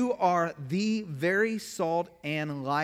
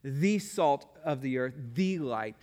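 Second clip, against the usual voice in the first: "The" and "the" pressed hard before salt and light; preacher driving home the definite article; stress on the; 3 semitones lower than usual. Shown as a demonstration. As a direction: hit "the" both times, emphatic. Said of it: "It's actually emphatic in the Greek".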